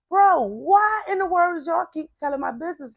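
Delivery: high, loud exasperated plea; exasperated protest. Retold or imitated. To family telling her business, loud retold exasperation.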